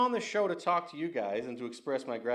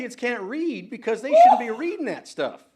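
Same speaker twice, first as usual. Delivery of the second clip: louder and higher, a real heated snap; riled retort; 9 semitones higher, 8 dB louder than usual. Real, not acted. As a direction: fire it back at the caller, genuinely riled. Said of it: "I'm just getting a little riled here".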